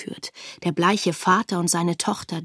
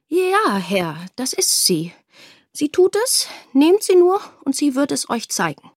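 high-pitched, over-eager assent; intimidated, eager; 6 semitones higher, 3 dB louder than usual. A narrator's reading. Cowed father handing over his daughter to soldiers, eager. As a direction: agree hastily to placate stern men. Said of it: "eingeschüchtert ... nickte sogleich eifrig".

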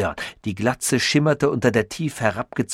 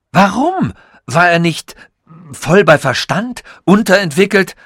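louder, sharp and defensive; indignant retort; 8 semitones higher, 7 dB louder than usual. Acted. Sasul snaps back at Tichy, louder and indignant. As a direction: throw the question back at him.

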